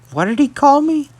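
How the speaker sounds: high-pitched, incredulous retold question; baffled question. Brother hears himself called Papyrus, baffled high question. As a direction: ask it in puzzled disbelief, pitch leaping up. Puzzled brother reacts to odd name.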